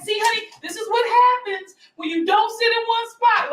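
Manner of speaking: high sing-song mother voice; mom lecturing. Retold or imitated. Mother telling her hurt son off, high and sing-song. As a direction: lecture the child sweetly, up high. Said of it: "She was just like".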